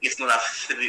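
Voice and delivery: ordinary talk, nothing marked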